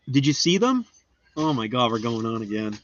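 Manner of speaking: ordinary talk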